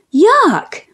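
high, disgusted yelp, falling; mock scream. A narrator's reading. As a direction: yelp high at narrator volume. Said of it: "screamed Billy".

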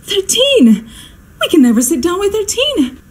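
high, falling cry of dismay; alarmed. Acted. Hostess faced with thirteen at dinner, alarmed. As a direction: pitch it up in superstitious alarm.

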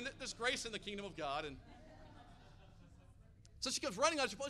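ordinary delivery

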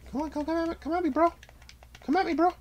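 very high falsetto goading; squeaky taunt. Pretend. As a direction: taunt the opponent in a tiny squeaky voice.

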